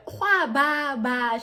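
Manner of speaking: loud, high drawn exclamation; hammed-up outcry; acted cry. Acting out an exclamation.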